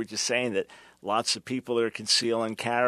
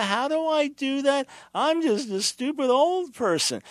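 high, whiny put-on old fogey; mock helpless old person; 11 semitones higher, 3 dB louder than usual. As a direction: play the clueless parent for laughs. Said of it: "They can't figure".

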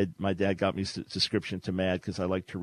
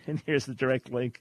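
normal talking voice

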